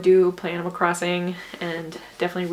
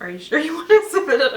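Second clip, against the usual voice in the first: fast, louder, laughing through the prompt; laughing read; 5 semitones higher, 5 dB louder, faster than usual. Real, not acted. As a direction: read the prompt in nervous giggles. Reading the confirmation prompt aloud.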